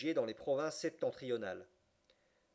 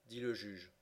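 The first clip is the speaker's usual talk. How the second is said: ordinary delivery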